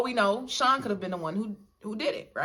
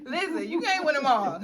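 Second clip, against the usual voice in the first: higher laughing shrug-off; 8 semitones higher, 3 dB louder than usual. Real, not acted. Cohost teased about accusing people, laughing it off. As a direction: laugh off the jab.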